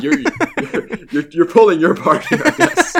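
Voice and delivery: teasing giggle between words; laughing. Real, not acted. Laughing while teasing cohost.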